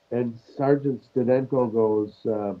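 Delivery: ordinary talk, nothing marked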